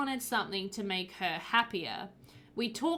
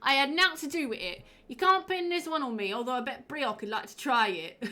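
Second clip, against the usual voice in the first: hammed-up Cockney, pitched higher; ridiculous Cockney accent; 4 semitones higher, 3 dB louder than usual. Shown as a demonstration. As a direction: ham up a silly Cockney villager. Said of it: "read this in this ridiculous accent".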